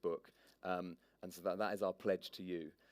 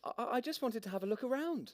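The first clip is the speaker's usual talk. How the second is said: put-on much higher, stammering girl; nervous girl voice; 14 semitones higher, faster than usual. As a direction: stammer it out timidly. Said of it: "Replied Maya".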